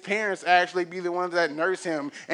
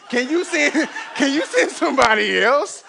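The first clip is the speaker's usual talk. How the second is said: laughing through the words, higher and louder; 7 semitones higher, 6 dB louder than usual. Real, not acted. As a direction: crack up while begging to be let off. Laughs through Moses' plea.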